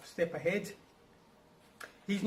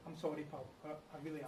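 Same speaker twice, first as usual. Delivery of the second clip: sheepish apology; 4 semitones lower, 12 dB quieter than usual. Acted. Guilty apology.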